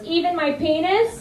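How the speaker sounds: put-on high, innocent little-boy question; cheeky kid. Boy asking the yoga teacher, high and innocent. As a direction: ask it like a sly five-year-old. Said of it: "that little shit, Oliver, goes".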